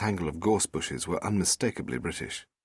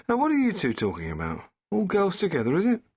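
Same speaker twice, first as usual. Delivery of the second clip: playful rising tease; the Doctor, teasing; 11 semitones higher, 3 dB louder than usual. Acted. Doctor teasing the TARDIS and sleeping Rose. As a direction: chide the machine like a fond uncle.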